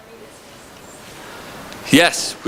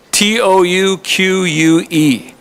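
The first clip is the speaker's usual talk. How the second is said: slow letter-by-letter spelling, higher; spelled out; 5 semitones higher, much slower than usual. Shown as a demonstration. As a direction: spell each letter of T O U Q U E slowly. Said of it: "Say it with a Canadian accent".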